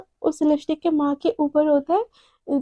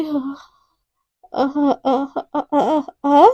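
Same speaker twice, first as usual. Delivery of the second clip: out of breath; slower than usual. Acted. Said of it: "अटक जाती है एकदम सांसें".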